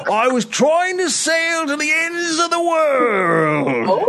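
high, slow, cried-out sailor lament; dramatic cry. Acted. Sailor grandly wails to the family about sailing. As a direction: wail it like a lost wanderer.

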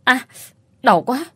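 woman cries out as her foot is set; cry out in pain. Acted. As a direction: yelp as the ankle is snapped back. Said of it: "Tôi la lên".

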